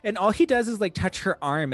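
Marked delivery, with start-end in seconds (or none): none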